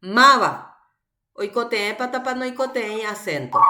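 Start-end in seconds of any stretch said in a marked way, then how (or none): 0.0-0.5 MAVA with the first syllable punched loud and long; stressed syllable demonstration. Shown as a demonstration. Teacher shows students where MAVA is accented, stressed demonstration. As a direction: hit the MA in MAVA hard. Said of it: "el acento a los que necesitan".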